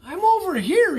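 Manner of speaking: high, loud call through the storm; yelling. A narrator's reading. Bullfrog yelling to rescuers, high loud call. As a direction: yell it over the rain. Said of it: "he yelled".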